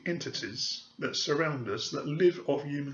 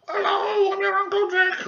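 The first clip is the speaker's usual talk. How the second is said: shrieked much higher and louder, a silly falsetto; stupid spirit voice; 9 dB louder than usual. Retold or imitated. Mocking mediums who fake spirits, screeching silly falsetto. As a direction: ham up a ridiculous channelled spirit. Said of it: "or some stupid voice".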